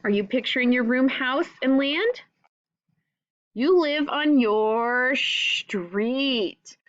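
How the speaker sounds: ordinary delivery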